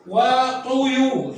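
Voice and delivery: وطيور drawn out syllable by syllable, very slow; slow sounding out. Shown as a demonstration. A teacher sounds out the word for children, very slow. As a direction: stretch each part of وطيور. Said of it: "صار يو".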